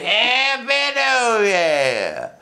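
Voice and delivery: tipsy slurred toast; drunken greeting. Retold or imitated. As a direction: stretch each word like a dazed reveler. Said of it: "I'd be like".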